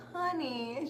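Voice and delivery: lightly voiced gentle old psychic, soft and high; a hint of a cooing grandma saying honey; sweet old lady. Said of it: "she's like".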